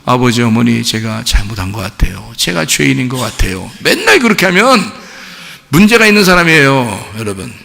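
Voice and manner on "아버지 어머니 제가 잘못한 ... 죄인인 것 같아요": low, subdued retold confession; a touch of mournful pleading; mock tearful confession. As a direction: imitate a weepy child owning up, understated. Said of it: "눈물을 펑펑 흘리면서".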